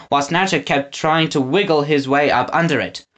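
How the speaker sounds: ordinary delivery, nothing marked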